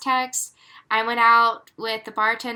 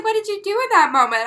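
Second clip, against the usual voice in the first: high, laughing mock question; 10 semitones higher, 5 dB louder than usual. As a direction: ask it through laughter. [name] asking while laughing.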